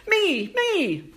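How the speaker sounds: high squeaky centipede voice; tiny little voice. A narrator's reading. Centipede calling up to the team, high and tiny. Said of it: "they hear a little voice".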